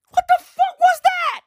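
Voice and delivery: very high, loud squeal of bewilderment; hysterical disbelief. Acted. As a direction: shoot way up into a squeaky mock outburst. Same quote again, far more frantic.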